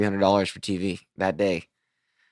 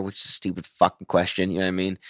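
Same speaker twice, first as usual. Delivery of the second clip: usual speaking voice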